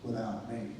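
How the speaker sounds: low, soft inner voice, fading away; still small voice. Retold or imitated. God speaking to him as he walks back, still small voice. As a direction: say it small and low, sinking to almost nothing. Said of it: "I heard that still small voice".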